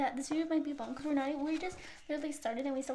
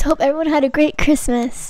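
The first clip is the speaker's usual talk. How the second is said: normal talking voice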